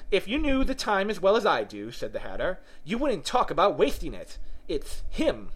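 high, lively Hatter voice; know-it-all Hatter. Acted. Hatter correcting Alice about time, high know-it-all voice.

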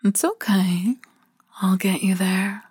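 ordinary talk

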